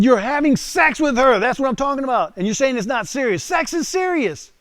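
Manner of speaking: loud, high-pitched tirade; heated scolding. Retold or imitated. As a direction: re-enact shouting sense into him. Acted scolding of puzzled Marine.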